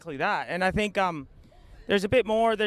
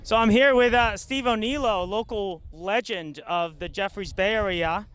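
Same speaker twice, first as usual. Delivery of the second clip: eager sideline reporter, higher and louder; breathless sideline reporter; 3 semitones higher, 3 dB louder than usual. Acted. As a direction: pitch it like a beachside reporter. Field reporter on the beach.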